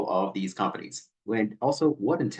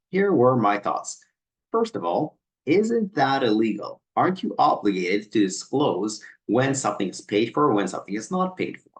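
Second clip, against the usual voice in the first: normal talking voice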